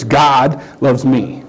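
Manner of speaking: "God" set apart and leaned on, then "loves me"; slow line with the weight on "God"; stress on GOD. Shown as a demonstration. Preacher showing the congregation how to emphasize different words, stress on "God". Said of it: "emphasize the different words".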